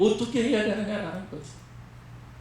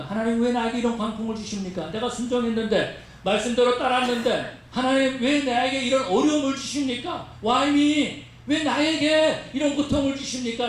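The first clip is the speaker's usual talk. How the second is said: resentful complaint; 3 semitones higher than usual. Acted. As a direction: protest to God, rising with each question. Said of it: "하나님을 원망할 때가 있다라는". (점점 높아지는 원망 섞인 호소)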